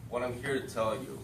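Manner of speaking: usual speaking voice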